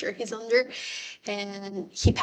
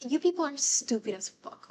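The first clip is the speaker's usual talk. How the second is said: usual speaking voice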